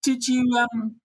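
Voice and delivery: put-on high-pitched East End boy's voice, eager; Billy's East End accent. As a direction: imitate a cheeky little East End lad, pitched well up. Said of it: "excuse the awful East End accent".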